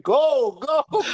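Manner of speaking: high, eager go go go; retold coaching cheer, high pitched; excited cheering. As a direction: wave the runners on, laughing at yourself. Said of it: "I'm like".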